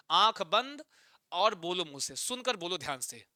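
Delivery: usual speaking voice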